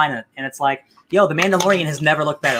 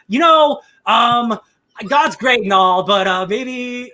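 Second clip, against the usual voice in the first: wavering faith; 6 semitones higher, 4 dB louder, much slower than usual. Retold or imitated. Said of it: "he's like".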